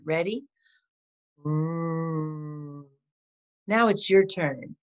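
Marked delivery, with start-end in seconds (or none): 1.5-2.8 R sound demo. Shown as a demonstration. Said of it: "let's do it together".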